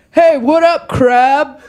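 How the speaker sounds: imitated bro greeting, hollered; heckling bro. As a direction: call out like a passing bro. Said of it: "this bro was like".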